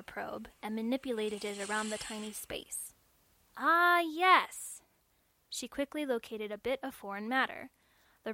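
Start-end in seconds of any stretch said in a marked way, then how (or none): 3.6-4.8 loud, high "Ah, yes!" of discovery; excited discovery. Acted. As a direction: cry out as you find it. Registrar finds the bead.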